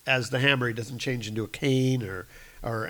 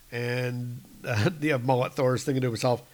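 ordinary delivery

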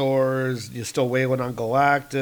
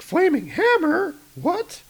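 much higher astonished cry; startled. Acted. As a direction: react to a flying hammer in shock. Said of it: "she's like".

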